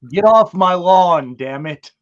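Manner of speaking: hammed-up old-man grumbling shout; grumpy old man. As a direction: play an old man yelling off the porch. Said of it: "the old man yells at cloud".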